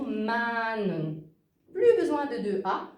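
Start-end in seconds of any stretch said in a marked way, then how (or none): 0.0-1.2 MAN with a long, drawn-out A; son long. Shown as a demonstration. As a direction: stretch the vowel in MAN. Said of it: "il est long". (« man » avec un A long et étiré)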